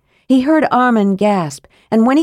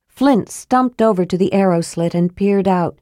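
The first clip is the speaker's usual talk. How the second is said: usual speaking voice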